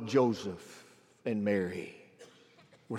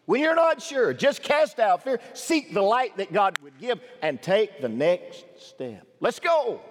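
raised, urgent preacher's exhortation; preaching; 6 semitones higher, 5 dB louder, slower than usual. Acted. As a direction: press the listeners to step out in faith. Pastor exhorting the congregation.

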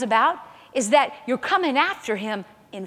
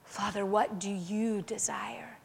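slow, hushed, near-whispered plea; almost whisper it to the Father; soft, slow, intimate asking; intimate prayer; 6 dB quieter than usual. Shown as a demonstration. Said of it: "We need to talk to him like that".

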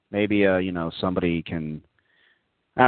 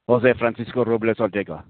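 Spanish accent; 2 semitones higher than usual. Shown as a demonstration. Host repeats the name, Spanish-style.

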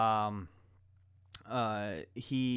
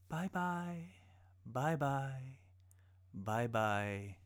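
mock farewell; 3 semitones higher, much slower than usual. History teacher mimics farewell to doomed railroad worker, mock farewell. Acts out Chinamen blown off cliff.

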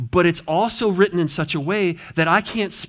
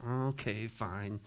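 resigned mock mutter; mock guilty mumble; 6 semitones lower, 10 dB quieter than usual. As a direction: lower and much softer, beaten down. Mock guilt-tripped listener.

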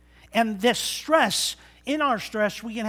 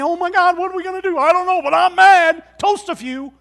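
frantic, higher and louder panic; mock panicking God; 8 semitones higher, 9 dB louder than usual. God panicking to Jesus, hammed-up frantic and loud. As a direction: ham up a God losing his cool. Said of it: "God didn't look at Jesus and say".

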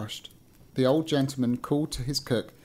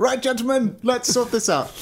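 high, loud mock-official cat voice; arbitrating Christmas cat; 9 semitones higher, 5 dB louder than usual. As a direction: pipe up loudly as a cat taking charge. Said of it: "ready for a Christmas cat to appear and".

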